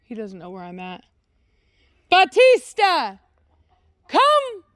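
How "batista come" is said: high, loud call across the yard; shouting for the dog to come. Real, not acted. Calling dog from distance.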